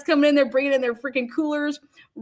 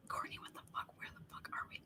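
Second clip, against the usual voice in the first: whisper; 28 dB quieter, faster than usual. Retold or imitated. Aside to friend on the tour.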